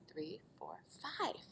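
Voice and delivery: whispered counting. Shown as a demonstration. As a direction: count under your breath.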